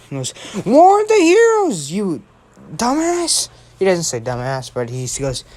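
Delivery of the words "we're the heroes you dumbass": high, drawn-out indignant yell; mock yelling. Ida scolding Deku for sitting by idly, mock yelling. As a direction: squawk it in hammed-up outrage. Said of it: "just stop yelling".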